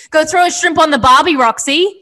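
Australian accent. Shown as a demonstration. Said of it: "Do your best Australian accent".

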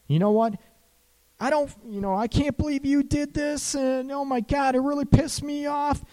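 high-pitched acted rant; mock outrage. Coach acting out a tit-for-tat quarrel, mock outraged. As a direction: play an aggrieved partner, voice way up. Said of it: "How many people get into this".

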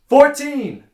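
very high, very loud shout; calling out. Acted. Prisoner telling his first joke to the jail, big acted yell. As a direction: belt the number out with full force. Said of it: "I think I'm gonna tell my first joke".

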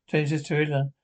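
ordinary delivery, nothing marked

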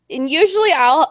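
ordinary delivery

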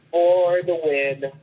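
ordinary delivery

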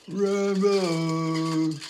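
loud, high, slow trader's bellow; hoarse cockney street cry. Shown as a demonstration. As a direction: cry it out like a rag and bone man. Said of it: "it's like this".

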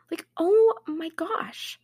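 slow, high exasperation; exasperated. Real, not acted. Fed up with her brother's excuse, slow exasperated sigh of words. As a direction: drag it out, fed up.

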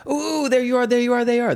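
put-on very high, squealing child voice; mock impatient kids. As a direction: imitate overexcited kids pouncing. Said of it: "They weren't like".

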